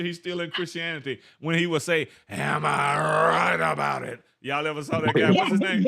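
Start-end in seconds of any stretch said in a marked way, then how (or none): 2.3-4.2 tough preacher's slow, drawn-out roar; Jennings. Acted. Teacher impersonating a tough preacher for laughs, throaty drawn-out bellow. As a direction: growl it like a wrestler, stretching every word. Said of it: "that tough preacher".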